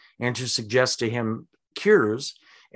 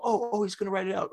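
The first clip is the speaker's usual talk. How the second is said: higher, quick eager woman's voice; waitress relieved he will write his order; excited waitress; 8 semitones higher, 5 dB quieter, faster than usual. Retold or imitated. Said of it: "the woman's all".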